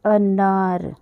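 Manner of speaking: अनार said as one smooth word, teacher-clear; clear model word. Shown as a demonstration. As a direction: say अनार once, crisply, as the model. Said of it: "ये शब्द है".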